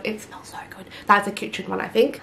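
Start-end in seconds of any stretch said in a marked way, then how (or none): 0.0-0.9 whispering. Real, not acted. Whispered delight.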